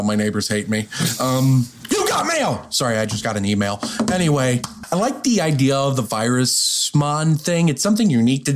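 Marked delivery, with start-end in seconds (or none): 1.9-2.6 high-pitched mock scream of the line; sudden high comic holler; screamed catchphrase. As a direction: yell it high, as if an email just arrived. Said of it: "I scream that every time I get an email".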